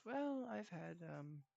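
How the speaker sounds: soft, hesitant reply, lightly acted; tired grandson. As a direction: answer your grandfather quietly, with pauses.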